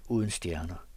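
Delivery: ordinary delivery, nothing marked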